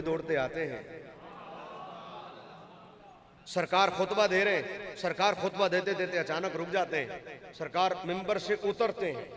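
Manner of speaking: soft, low sermon storytelling; हदीस का बयान. A narrator's reading. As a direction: tell the sacred scene quietly, phrase by phrase. Narrates hadith scene softly. (धीमी, नर्म आवाज़ में वाक़िआ सुनाना)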